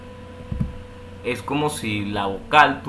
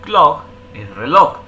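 slow; 7 dB louder than usual. Shown as a demonstration. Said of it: "Por ejemplo".

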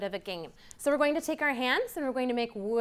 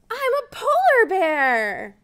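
higher, bright announcing voice; excited reveal; 8 semitones higher, 7 dB louder than usual. Acted. Teacher revealing the answer to children, excited. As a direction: proclaim the answer like a prize.

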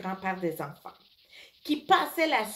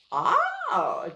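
high ah of understanding; an aha moment; sudden realization; 4 dB louder than usual. A narrator's reading. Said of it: "dit Lincoln".

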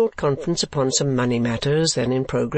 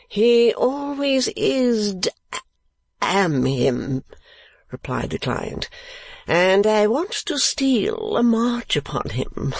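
put-on high, slow drawling young lord; foolish lordly dupe; 8 semitones higher, slower than usual. Client pressing Nickleby for the niece's address, high foolish drawl. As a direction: drawl it out high, pitch swooping, like a silly aristocrat.

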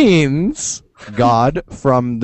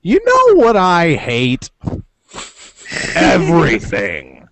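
slow, grumbling deadpan hater; lightly voiced grouch, slow and dry; mock grumpy quote; 3 semitones higher, slower than usual. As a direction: drag it out like a sour grump. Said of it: "he's just like".

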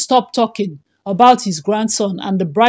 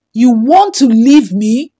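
slow, louder furious shout; 5 dB louder than usual. A narrator's reading. Husband shouts at his leaving wife, slow and loud. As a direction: shout it out at her, slowly. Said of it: "Chike shouted".

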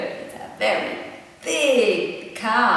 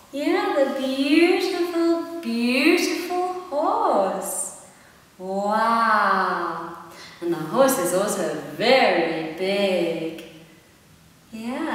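ordinary delivery

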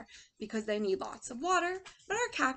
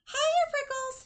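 high, loud teasing hello; shouted; 5 dB louder, slower than usual. A narrator's reading. A passing jackrabbit greets Hank, shouting high. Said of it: "he shouted".

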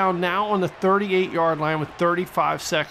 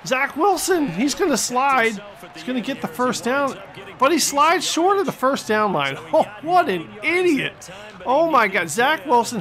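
high, excited call tipping into laughter; incredulous excited call; 6 semitones higher than usual. Real, not acted. Fan watches quarterback slide short, gleefully incredulous. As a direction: laugh in disbelief at the blunder.

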